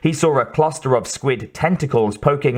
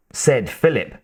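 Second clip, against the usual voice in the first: ordinary delivery, nothing marked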